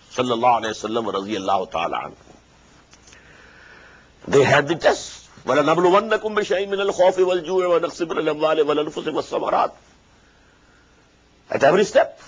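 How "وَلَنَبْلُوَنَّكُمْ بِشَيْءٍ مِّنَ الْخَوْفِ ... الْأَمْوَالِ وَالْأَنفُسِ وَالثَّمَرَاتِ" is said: fast, flowing Quranic recitation. Acted. Preacher quoting the Quran to the congregation, fast recitation. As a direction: rattle off the verse in one breath.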